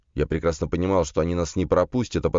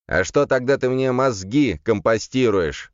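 slow, higher-pitched indignation; indignant demon; 7 semitones higher, 4 dB louder, slower than usual. A narrator's reading. Demon complaining that his master wastes his time, indignant voice. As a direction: protest indignantly, drawing the words out. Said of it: "возмутился демон".

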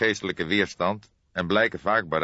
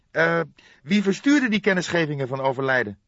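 higher, louder detective voice with a hesitant eh; Fledder's voice; 10 semitones higher, 4 dB louder than usual. A narrator's reading. Detective Fledder asks the cook who sent the notices, high voice. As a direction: lift the inspector's question up high.

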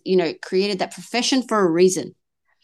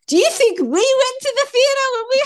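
much higher, louder mock scold; exasperated scolding; 4 dB louder than usual. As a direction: ham up the fed-up mum, way up high. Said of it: "I was really annoyed".

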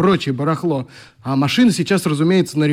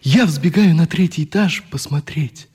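poem reading. Acted. Oleg reading his own poem to the boys, earnest. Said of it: "Вот эти строчки".

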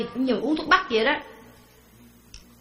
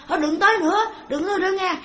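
high-pitched imitated pleading; mock frightened outcry; 8 semitones higher, faster than usual. Preacher mimics a haunted man begging spirits away, mock panic. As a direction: act out warding off ghosts in a raised, high voice. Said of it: "la lên".

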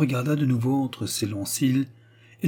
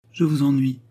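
higher, plaintive note; piteous; 4 semitones higher, 4 dB louder than usual. A narrator's reading. Suitor fearing he bores Raymonde, mildly piteous. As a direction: say it a little pitifully. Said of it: "fit piteusement Préfontaine".

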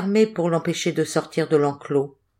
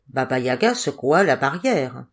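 usual speaking voice

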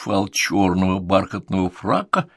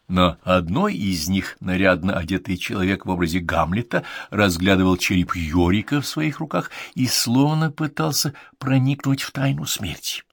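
ordinary delivery, nothing marked

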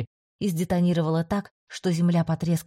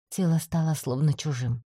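usual speaking voice

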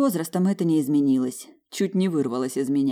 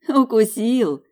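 giggling; 6 semitones higher, 7 dB louder, much slower than usual. Acted. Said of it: "хихикнула Маржа".

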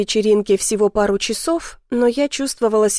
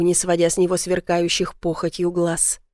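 plain reading voice

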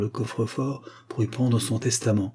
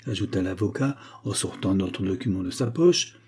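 plain reading voice

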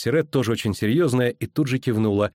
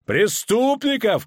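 high, slow, bitter shout; 12 semitones higher, slower than usual. A narrator's reading. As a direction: spit the word back. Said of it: "крикнул Риордан". (гневный выкрик)

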